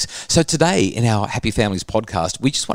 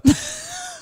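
squealing laugh, pitched way up; high burst of laughter. Real, not acted. Laughing at whisker remark.

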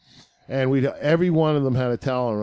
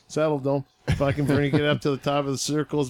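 normal talking voice

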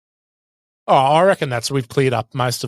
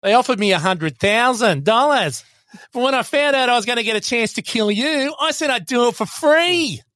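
Bennett impression; 10 semitones higher than usual. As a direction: purr it like the gleeful villain Bennett. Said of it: "another Bennett one".

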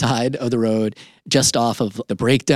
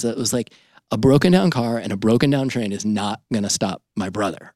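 usual speaking voice

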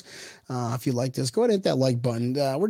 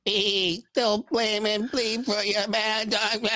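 high, slow, strained llama bleat; spitting llama voice; 6 semitones higher, 4 dB quieter, slower than usual. Pretend. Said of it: "my famous llama voice".

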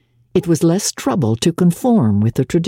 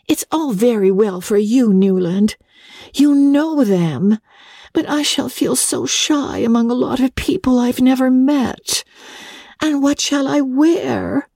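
put-on raised, anxious young woman's voice, slowing on the worry; a fretful bride fussing over what to wear; May, fretful young wife; 10 semitones higher than usual. Young wife frets to husband about dinner, raised anxious voice.

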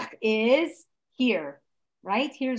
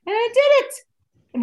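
high, loud, drawn-out triumph; triumphant declaration; 12 semitones higher, 7 dB louder, slower than usual. Acted. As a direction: announce the achievement proudly, slow and ringing. Voices listener's triumph over trouble.

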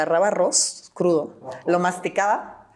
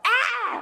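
eagle cry imitation. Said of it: "Así unos gritos".

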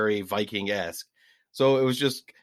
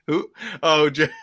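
ordinary talk

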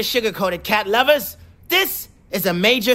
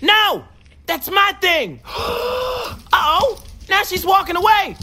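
panicked; 8 semitones higher than usual. Acted. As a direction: cry out in alarm. Cat panics at dog licking.

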